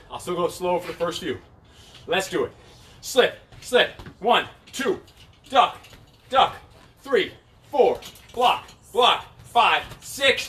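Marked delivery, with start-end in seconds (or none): 3.0-10.5 high, punchy move calls; boxing coach calling combos. Real, not acted. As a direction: call the combo with energy.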